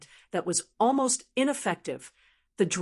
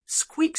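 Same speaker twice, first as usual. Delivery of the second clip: high piercing rodent squeak; one high mock squeak; rat squeak; 9 semitones higher than usual. Burning soul squeaks.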